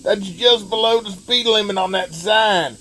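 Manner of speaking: terrible Larry the Cable Guy. Acted. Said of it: "that was me doing a terrible Larry the Cable Guy impersonation".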